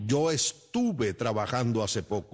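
"estuve" said clearly as es-TU-ve, every sound pronounced; correct pronunciation. Shown as a demonstration. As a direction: slowly model the word "estuve" in full. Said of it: "Digo que se dice estuve".

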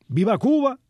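retold shout. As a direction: imitate someone crying out a slogan. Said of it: "una persona gritó".

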